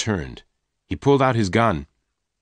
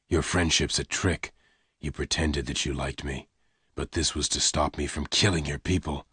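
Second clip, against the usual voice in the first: lower, softer, held back; low pained gunman; 5 semitones lower, 7 dB quieter than usual. Acted. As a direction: push her away quietly. Lassiter accuses quietly.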